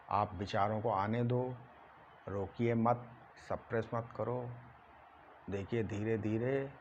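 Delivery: soft, low meditation voice with long pauses; guided meditation. Acted. Yoga teacher guiding students to watch their thoughts, hushed meditation.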